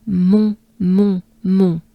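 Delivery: "Mon" with very closed nasal vowel. Shown as a demonstration. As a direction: drill French "Mon", closed up. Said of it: "It's very closed, nasal, closed up".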